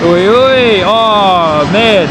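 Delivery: excited exclaim. Real, not acted. Spotter thrilled by a go-around on the live stream, excited. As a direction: shout it out with delight.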